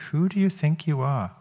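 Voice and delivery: high, mock-scolding inner critic; scornful critic voice. Teacher voices the inner critic for listeners, high scornful challenge. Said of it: "Does that voice sound familiar?".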